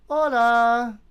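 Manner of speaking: loud bright greeting; shouted hola. Pretend. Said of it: "[name], say hola".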